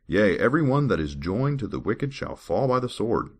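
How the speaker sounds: measured, formal Bible reading voice; scripture reading. Acted. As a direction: read the verse like a solemn lesson. Reads Bible verses aloud.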